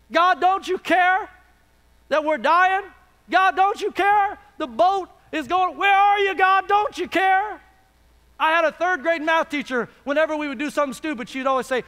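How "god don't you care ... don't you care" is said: building, higher cry to God; acted-out desperate shouting; desperate plea. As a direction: plead louder and higher, as if dying. Acting out disciples' desperate plea.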